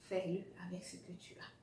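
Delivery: quick intimate whisper. Acted. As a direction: whisper it like a secret tip. Coaching advice, whispered.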